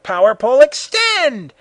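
loud command rising high; yell. A narrator's reading. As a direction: yell a command, climbing to a peak. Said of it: "Then Goku yelled".

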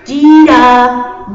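loud, stretched-out singsong nagging; slow, loud singsong pestering. Acted. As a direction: drag the name out to annoy. Goku pestering Vegeta by name.

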